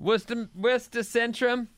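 put-on Boston accent, dropped r's. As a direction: say it like a Boston local. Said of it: "is that your Boston accent?".

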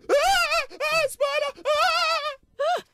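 very high-pitched mock shriek; imitated hysterical screaming. Man screaming at a spider, imitated hysterical shriek. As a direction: scream like someone terrified of a spider. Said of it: "a woman screaming hysterically".

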